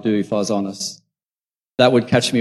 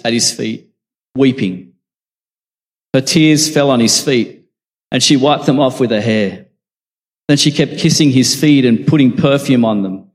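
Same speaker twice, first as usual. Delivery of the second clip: projected, animated Bible reading, a little raised; scripture reading; 3 semitones higher, 5 dB louder than usual. A narrator's reading. Preacher reads of the weeping woman and the Pharisee, projected scripture reading. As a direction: read the story out firmly to a congregation.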